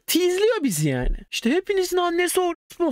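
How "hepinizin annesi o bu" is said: taunting insult. Retold or imitated. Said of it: "falan dedi".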